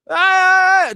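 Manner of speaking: high, loud held "ah"; mock screaming chant. Griping about the noise, mock screaming wail. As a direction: imitate a call to prayer as a scream. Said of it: "it just sounds like screaming to me".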